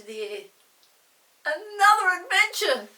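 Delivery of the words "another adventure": put-on excited girl. Molly excited.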